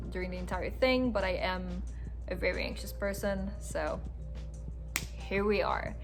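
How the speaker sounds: usual speaking voice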